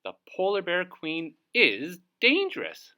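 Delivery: a shocked little bird; high astonished bird; Dovekie Lovekie's voice. Acted. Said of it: "said Dovekie Lovekie".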